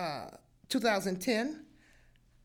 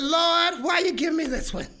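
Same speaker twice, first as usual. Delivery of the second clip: crying out to God; 5 semitones higher, 7 dB louder than usual. Retold or imitated. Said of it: "I said".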